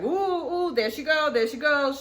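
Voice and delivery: high-pitched mock commotion; imitating a gossiping crowd. Onlookers reacting to her throwing things, mock excited hooting. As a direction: hoot it like onlookers egging on drama. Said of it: "everybody's like".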